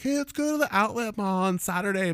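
high-pitched mock girlfriend voice; imitated girlfriend. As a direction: chirp it like a girlfriend planning an outing. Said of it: "whenever your girlfriend's like".

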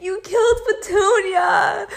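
high, loud, over-the-top crying wail; mock the dramatic grief of a movie girl; movie heroine wailing over her wrecked pink car, dramatic sobbing shout. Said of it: "And it was all dramatic".

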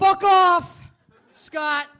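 fake-furious brush-off to the host; joking blowup at the pushy co-host; mock anger. Guest snaps at host's pushing.

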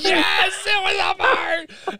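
high, slow, laughing confession; owning up to the fart mid-laugh; laughing admission. Retold or imitated. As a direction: squeak it out through laughter. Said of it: "And I'm like".

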